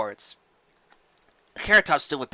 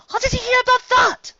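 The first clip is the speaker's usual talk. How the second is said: much higher, startled question; surprised squeak; 7 dB louder than usual. Acted. Carrot Top startled by Fancy Pants' knowledge, high squeak. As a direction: catch her off guard.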